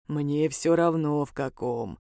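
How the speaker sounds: low, deep, indifferent creature; deep-voiced businesslike demon. A narrator's reading. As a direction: state terms in a demon's bass. Demon answers in his bass.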